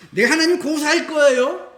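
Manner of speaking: half-hammed threat to sue God; mock protest. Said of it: "욥처럼 막 울부짖고 막 따지고".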